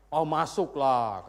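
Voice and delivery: drawn-out, breezy self-assured answer; mock complacent churchgoer; breezily sure. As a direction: stretch it out as if obviously so. Acted self-assured answer to question.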